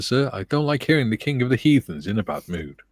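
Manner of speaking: usual speaking voice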